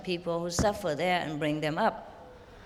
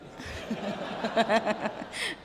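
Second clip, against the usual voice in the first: laugh; 4 dB quieter than usual. Real, not acted. Master laughing mid-question.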